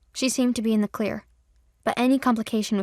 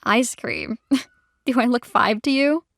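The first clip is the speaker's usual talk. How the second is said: laughing in surprise; amused disbelief with a laugh; surprised chuckle; 4 dB louder than usual. A narrator's reading. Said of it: "Trinity chuckled in surprise".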